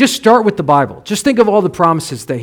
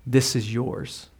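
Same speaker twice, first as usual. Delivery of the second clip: tender reveal; 4 semitones lower, 9 dB quieter than usual. Retold or imitated. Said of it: "they say something like".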